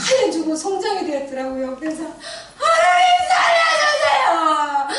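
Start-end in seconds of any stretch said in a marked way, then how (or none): 2.6-4.9 very high, very loud, drawn-out shout; a wife's piercing cry for her husband's life; desperate scream. Retold or imitated. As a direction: scream to God at the top of your lungs. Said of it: "절박하게 정말로 간절한 심정으로".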